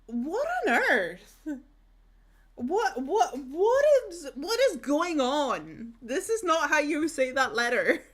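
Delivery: loud, incredulous "what on earth"; retold disbelief. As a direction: replay your own shock at her. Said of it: "And I was just like".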